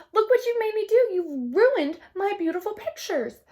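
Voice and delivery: high, loud, upset scolding; upset cry. Acted. Girl scolding the monkey for ruining her pictures, a high loud upset cry. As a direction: blame him, loud and hurt. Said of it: "the girl cried".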